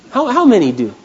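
ordinary delivery

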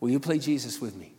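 ordinary talk, nothing marked

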